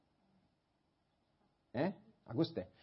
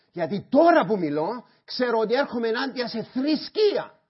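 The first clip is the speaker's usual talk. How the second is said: full-force shouting sermon, much higher and louder; preaching shout; 10 semitones higher, 12 dB louder than usual. Real, not acted. Pastor shouting to the church about God's love, full-force preaching. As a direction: thunder it at the congregation. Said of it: "όχι γιατί κάποιος φωνάζει σαν εμένα".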